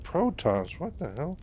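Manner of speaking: mock bewilderment; taken aback. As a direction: act out stunned disbelief. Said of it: "He's just kinda taken back".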